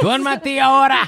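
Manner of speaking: high, drawn-out mock command; mock yelling. Dad parodies his night order, mock yell. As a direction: order sleep in a hammed-up high voice. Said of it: "I can't believe yelling".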